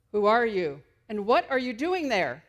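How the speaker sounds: loud call from up on the wall; guard's repeated yell down. Retold or imitated. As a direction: demand it again. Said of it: "yells down".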